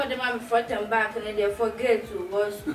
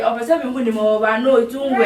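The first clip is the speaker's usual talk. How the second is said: ordinary talk, nothing marked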